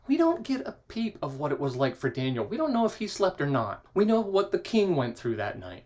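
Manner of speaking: ordinary talk, nothing marked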